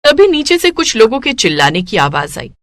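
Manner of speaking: plain reading voice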